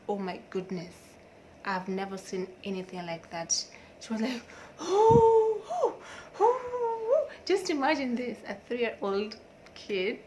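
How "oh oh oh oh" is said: loud, high-pitched howls in bursts; toddler tantrum wail. Retold or imitated. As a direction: imitate a small child wailing in a tantrum. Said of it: "She started these tantrums".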